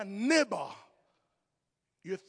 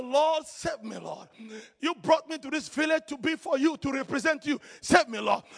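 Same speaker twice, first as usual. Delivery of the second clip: retold plea; 4 semitones higher, 9 dB louder than usual. Retells his urgent prayer for rescue.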